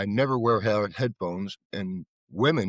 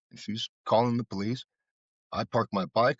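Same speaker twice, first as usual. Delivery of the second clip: normal talking voice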